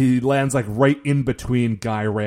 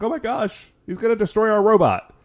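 higher-pitched, lightly panicked quote; mock freaking out; 8 semitones higher than usual. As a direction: imitate worried scientists fretting. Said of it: "kind of freaking out".

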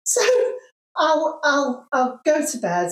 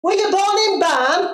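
higher, louder Yorkshire "born in Barn"; Yorkshire accent; 9 semitones higher, 5 dB louder than usual. Shown as a demonstration. Mum showing a Yorkshire saying in Yorkshire accent. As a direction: drop the "a" to "Barn", Yorkshire style. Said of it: "that's more Yorkshire accent".

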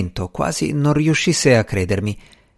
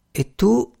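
wary question, pitched up high; distrustful; 8 semitones higher than usual. A narrator's reading. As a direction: toss it back with suspicion. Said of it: "buttò lì con diffidenza".

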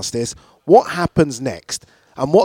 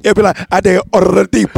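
mock Spanish accent; 6 semitones higher, 6 dB louder than usual. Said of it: "I got your name wrong".

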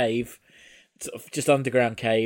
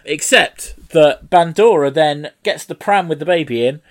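ordinary delivery

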